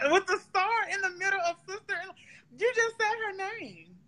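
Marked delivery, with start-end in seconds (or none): 2.6-3.9 teary amazement. Real, not acted. Stunned by friend's psychic hit.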